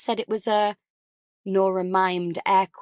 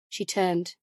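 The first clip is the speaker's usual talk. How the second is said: plain reading voice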